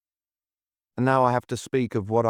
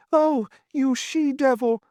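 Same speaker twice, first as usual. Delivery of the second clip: put-on high, slow anguished old man's cry; dying cry; slower than usual. Wounded old lord cursing his wife, high and slow. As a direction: curse her as you are struck down. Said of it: "he cried out".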